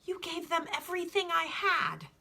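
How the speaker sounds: put-on high, upset boy's voice; distressed boy. Boy complains to the alchemist, high and upset.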